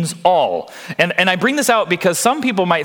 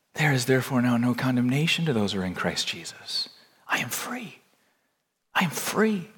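much softer, near-whispered proclamation; hushed declaration; 5 semitones lower, 9 dB quieter than usual. Acted. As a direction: speak the verse softly, as a private confession. Intimate declaration of freedom in Christ.

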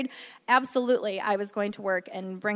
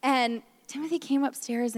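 usual speaking voice

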